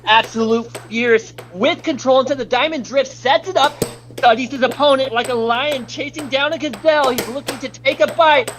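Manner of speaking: high, hyped sports caster call; play-by-play commentary. Pretend. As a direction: call the match like a live announcer. Mock esports play-by-play.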